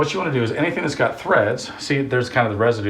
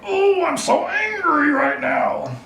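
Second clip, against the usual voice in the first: much higher, slow, hammed-up fury; a put-on high, slow, mock-furious king; mock angry king; much slower than usual. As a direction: ham up the king's tantrum, dragging it out high. King's reaction to defiance.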